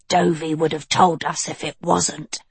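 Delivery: put-on cool, level young witch; Annadil voice.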